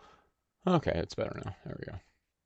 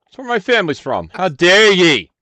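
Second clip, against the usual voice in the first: loud mock outrage in a Scottish accent; 7 semitones higher, 15 dB louder than usual. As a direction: yell it in a Scottish accent, faking offense. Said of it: "the Scottish accent thing".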